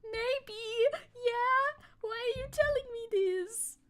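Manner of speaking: high squeaky voicing of the dog; dog's voice. Streamer voices her dog's puzzled reply in a high squeaky voice. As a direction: speak as the puzzled little dog. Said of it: "He's looking at me like".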